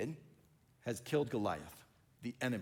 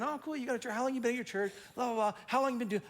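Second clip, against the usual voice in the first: chirpy small talk; 8 semitones higher, faster than usual. Retold or imitated. Acted small talk between pastors.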